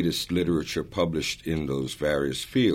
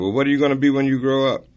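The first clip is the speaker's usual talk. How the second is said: mock grown-up question, higher, louder and fast; a family friend teasing a little boy, higher, louder and quick; quizzing a child; 10 semitones higher, 8 dB louder, much faster than usual. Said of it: "And I said".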